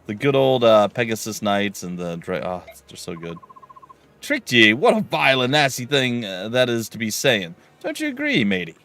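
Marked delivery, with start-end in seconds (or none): 4.2-8.8 louder, higher mock pirate captain. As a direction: ham up an oily pirate denying it. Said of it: "read pirate speak".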